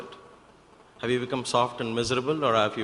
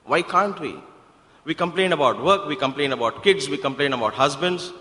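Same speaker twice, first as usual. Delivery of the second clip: raised, insistent sermon voice; emphatic preaching; 4 semitones higher, 5 dB louder than usual. Real, not acted. Preacher challenging listeners about their complaining, emphatic preaching. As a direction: preach it louder, hammering the repeated list.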